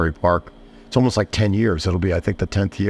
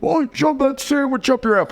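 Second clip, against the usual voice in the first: high, mock-angry retort; hammed-up insult at the customer; mock yell. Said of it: "me yelling at this guy".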